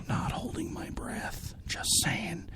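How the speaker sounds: soft unvoiced aside; whispering. Real, not acted. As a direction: whisper it under your breath. Aside about not blowing up.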